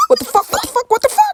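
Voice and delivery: high-pitched panicked screaming; loud, frantic repeated outburst; hammed-up meltdown. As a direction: shriek it over and over, theatrically. Acted meltdown after leaving office.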